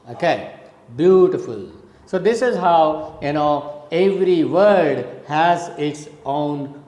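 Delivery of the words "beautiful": "beautiful" stressed on the first syllable, then dropping; falling rhythm. Shown as a demonstration. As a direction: hit the start of "beautiful" and step down. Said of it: "you go down from upside".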